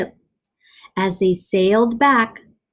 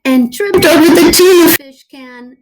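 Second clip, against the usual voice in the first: louder and higher, a big comic reveal; loud comic punchline; 6 semitones higher, 9 dB louder than usual. A narrator's reading. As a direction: belt the pratfall for laughs. Comic narration climax.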